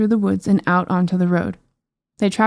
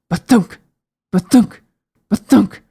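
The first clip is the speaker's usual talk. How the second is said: booming thumps; 6 dB louder than usual. Acted. Giant footsteps sound effect.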